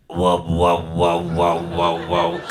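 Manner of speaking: imitated pulsing drone, evenly repeated; throbbing wow-wow noise. Comic describing a chip coma, low throbbing imitation. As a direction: make the sound of a throbbing coma sleep. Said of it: "It's just a throbbing".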